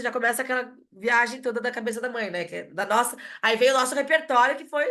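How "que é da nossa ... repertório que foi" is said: normal talking voice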